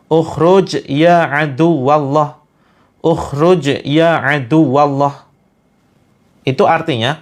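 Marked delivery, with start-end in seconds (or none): 0.1-2.4 Ukhruj ya adu wallah, slow and deliberate; slow memorising demo. Shown as a demonstration. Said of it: "Teman-teman hafalkan ya kalimatnya". 3.0-5.3 Ukhruj ya adu wallah repeated slowly, every word separate; slow second repetition for memorising; slow memorising demo. Shown as a demonstration. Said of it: "Teman-teman hafalkan ya kalimatnya".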